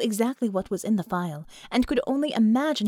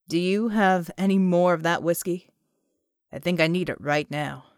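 John asks for whiskey, lower voice; John's voice; 4 semitones lower than usual. Acted.

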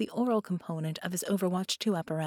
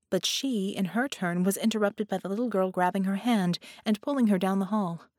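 usual speaking voice

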